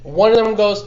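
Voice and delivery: ordinary delivery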